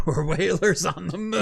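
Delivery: sung-ish line through real laughter; laughing. Real, not acted. Laughing while quoting a cartoon whaler song to co-host. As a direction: giggle through the words.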